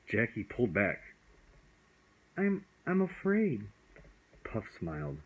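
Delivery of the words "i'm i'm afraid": put-on scared boy. Scared boy voices fear.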